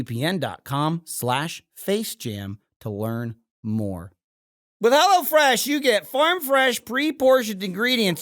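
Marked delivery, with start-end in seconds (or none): 4.8-8.2 booming ad announcer, higher. Acted. Bursts in announcing ad.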